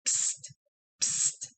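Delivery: soft hissed psst to get attention; whispered psst. Retold or imitated. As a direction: hiss it like a secret nudge. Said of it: "he keeps going".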